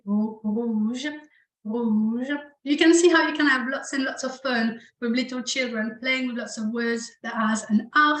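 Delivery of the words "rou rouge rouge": "rouge" built from a throaty R and a long oo; R joined to oo. Shown as a demonstration. Teacher puts the R with oo for learners, throaty drawn-out "rouge". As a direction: blend the throat R into a held oo for "rouge". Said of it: "I can put the R with it".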